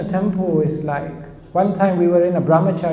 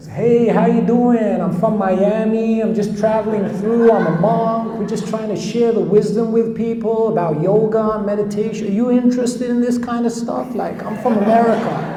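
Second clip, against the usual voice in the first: put-on American accent; 5 semitones higher than usual. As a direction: play a chatty monk from Miami in an American accent. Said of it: "speaking in American accent".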